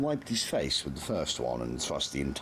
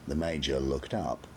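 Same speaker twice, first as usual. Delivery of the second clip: plain reading voice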